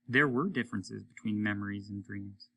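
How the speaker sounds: plain reading voice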